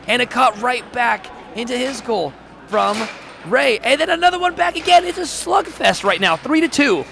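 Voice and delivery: climbing with each shot; excited play-by-play. Real, not acted. Commentator calling a slugfest of goals, excited. Rapid goals back and forth.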